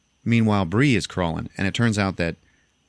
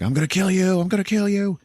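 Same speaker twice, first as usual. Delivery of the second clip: furious yell; 12 semitones higher than usual. Retold or imitated. Jealous Chris yells at Charlotte, high, hammed-up yell. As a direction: scream the threat across the room. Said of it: "So he's yelling at Charlotte like".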